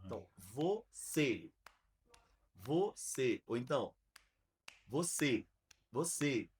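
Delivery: each você dropped on a metronome click, spaced out by pauses; speaking on the beat. Shown as a demonstration. Teacher demoing metronome timing for kids, evenly spaced beats. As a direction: land every "você" on the beat, falling. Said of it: "tá o metrônomo aqui".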